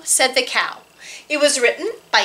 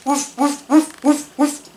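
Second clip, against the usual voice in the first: light mock woofs; barking sheep. Sheep barking in the field, lightly mock woofs. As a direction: bark like a puzzled sheep.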